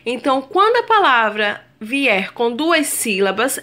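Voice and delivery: ordinary delivery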